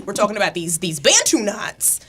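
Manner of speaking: ordinary talk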